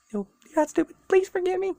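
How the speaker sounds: high, squeaky mock apology. Real, not acted. Narrator apologizing to listeners, high and squeaky. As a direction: take it back in a tiny voice. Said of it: "'cause you're stupid".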